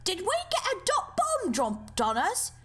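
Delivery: panicked shouting. Acted. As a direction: yell in alarm through smoke. Panicked characters after blast.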